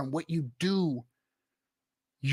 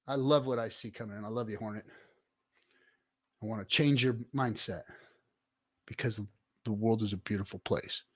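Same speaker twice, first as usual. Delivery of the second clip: much softer, lower, sincere; tender reassurance; 6 semitones lower, 9 dB quieter than usual. Real, not acted. Streamer reassuring Hornet he loves him, soft and tender. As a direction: speak it gently like a friend.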